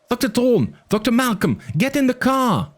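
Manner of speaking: put-on high, urgent kid's voice; a frightened boy pleading over the radio. A narrator's reading. Arby urges Thorn and Malcolm into the car, high and urgent.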